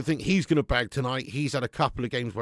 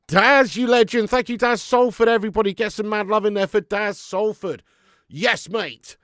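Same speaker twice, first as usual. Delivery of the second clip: excited loud thanks; 6 semitones higher, 7 dB louder than usual. Real, not acted. Thanking a super chat donor.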